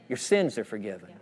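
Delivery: hushed, low, tender assurance; gentle reassurance. Acted. Preacher voicing what the man needed to hear, gentle reassurance. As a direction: drop low and soft to absolve. Jesus's forgiveness voiced gently.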